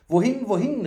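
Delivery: high, loud, eager question; loud calling fool. A narrator's reading. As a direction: call it out like an excitable fool. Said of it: "rief der Narr laut".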